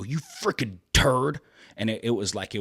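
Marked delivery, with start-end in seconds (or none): 0.0-1.4 higher, louder, slow, seething insult; furious name-calling. Retold or imitated. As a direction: spit it out like he's about to explode. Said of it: "he would just go".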